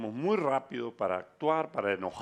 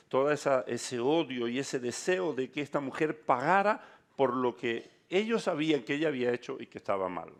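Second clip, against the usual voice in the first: ordinary talk, nothing marked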